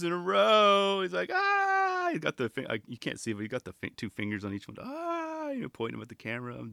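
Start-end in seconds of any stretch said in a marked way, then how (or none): 1.3-2.1 long, very high held cry; imitated triumphant high wail; high mock "aah". Mimics skater's bragging finger gesture. 4.8-5.5 soft, very high held cry; imitated bragging wail, kept quiet; high mock "aah". Mimics skater's bragging finger gesture.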